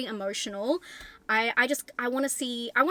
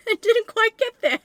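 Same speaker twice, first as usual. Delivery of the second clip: higher, louder, breaking into laughter; laughing; 12 semitones higher, 7 dB louder than usual. Real, not acted. Reviewer admitting the gore fell short, laughing. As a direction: laugh through the words.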